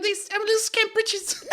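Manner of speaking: very high squeaky urchin voice; Little Scamp Britches. Retold or imitated. Urchin introducing himself, very high scamp voice. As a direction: squeak it like a tiny street urchin.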